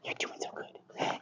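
slow, whispered praise to a dog; whispered princess voice. Shown as a demonstration. Trainer rewarding a dog, whispered princess voice. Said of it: "these extra princess voices that I'm doing".

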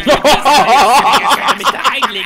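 slow, loud, laughing; laughing delight. Acted. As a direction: gush it out with a laugh. Dubbing animal, delighted about peas.

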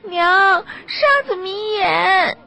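high, loud wailing cry; a little girl crying to her mother as sand fills her eyes; crying child. Acted. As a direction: sob it out as a frightened girl. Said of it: "进财的女儿哭着说".

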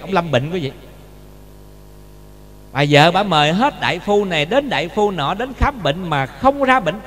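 ordinary talk, nothing marked

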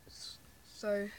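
ordinary delivery

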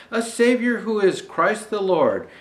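ordinary delivery, nothing marked

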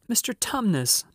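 high-pitched, mild bawl; understated high bawl of a child. A narrator's reading. Lucy in the sobbing faun's ear, mildly bawling. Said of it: "bawled Lucy in his ear".

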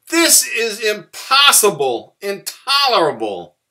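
high, very loud outraged shout; roar. A narrator's reading. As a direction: roar in exasperation at the racket. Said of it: "Bear started to roar".

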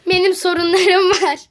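ordinary talk, nothing marked

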